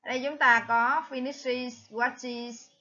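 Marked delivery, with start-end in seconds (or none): none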